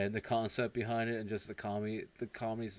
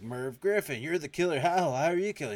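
rising high, louder disbelief; shocked accusation; 7 semitones higher, 6 dB louder than usual. Retold or imitated. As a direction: pile up the shock line by line. Detective confronts the killer.

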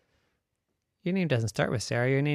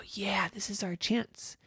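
conspiratorial whisper; 8 semitones higher, 6 dB quieter than usual. Acted. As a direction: whisper the plan to each other. Girls plotting.

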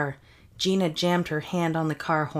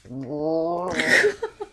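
moose bellow; 12 semitones higher than usual. Acted. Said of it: "You were good".

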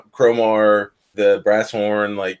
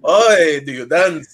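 light, hammed-up Russian accent, a bit louder; playful Russian-accented Grim; 5 semitones higher, 4 dB louder, slower than usual. Shown as a demonstration. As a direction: ask it in a mock Russian accent. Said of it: "you have to say it with a Russian accent".

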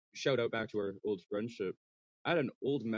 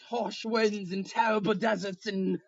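slow, much higher grand narration; mock epic storytelling; 11 semitones higher, 7 dB louder, slower than usual. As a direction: recite a legend, laughing at the end. Joins mock epic tale.

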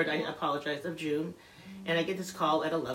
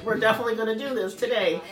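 excited take-charge daughter; 6 semitones higher, 8 dB louder than usual. Retold or imitated. Imitating daughter taking charge, excited.

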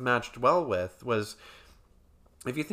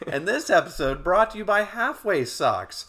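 high, loud sponsor announcer; mock ad read; 8 semitones higher, 7 dB louder than usual. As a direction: sell it like a spoof commercial.

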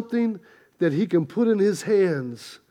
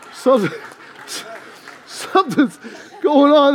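laughing through it; 5 semitones higher than usual. Real, not acted. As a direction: laugh while getting the punchline out. Laughing at his own joke.

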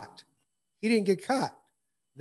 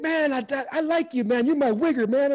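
a higher, louder buddy voice as Barry; imitating Barry; 7 semitones higher, 5 dB louder than usual. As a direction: lightly play the friendly teammate, pitched up. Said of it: "He's like".

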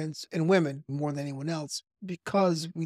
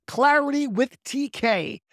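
big, slow announcer read, pitched up; radio voice; 6 semitones higher, 4 dB louder, slower than usual. Shown as a demonstration. Podcast host rehearsing intros out loud, radio voice. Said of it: "as radio voice as I can".

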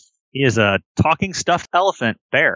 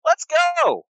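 high, sweet toy-animal voice; childlike plush friend; much slower than usual. Acted. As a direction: speak like an eager stuffed elephant bear. GM voices Billy Bucks.

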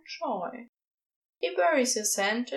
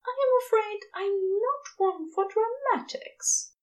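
high and proper, mock modest; a fussy, proper angel, gently teasing; prim angel voice; 11 semitones higher than usual. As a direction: deny any drama with a straight face. Aziraphale's prim reply.

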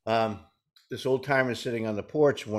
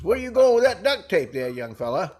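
put-on high, loud old-timer call; a lightly voiced old man hollering at the kid; 7 semitones higher, 5 dB louder than usual.

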